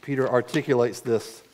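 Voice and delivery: ordinary talk, nothing marked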